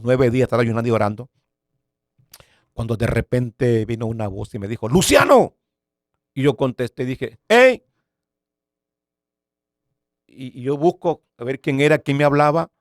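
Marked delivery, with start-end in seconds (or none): 4.9-5.6 sudden high, loud shout of a name; llamado fuerte. Retold or imitated. Voz que lo llama de repente. 7.5-7.8 high, loud answering shout; grito de respuesta. Retold or imitated. Responde al llamado de la voz.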